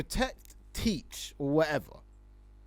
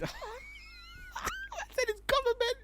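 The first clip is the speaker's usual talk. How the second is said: laughing; much slower than usual. Real, not acted. Laughing over the name.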